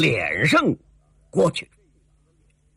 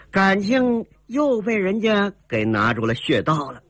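ordinary delivery, nothing marked

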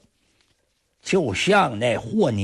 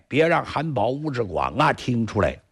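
ordinary delivery

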